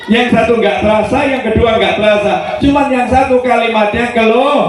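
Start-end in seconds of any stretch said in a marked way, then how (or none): none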